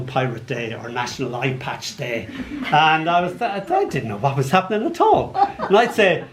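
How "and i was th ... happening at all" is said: usual speaking voice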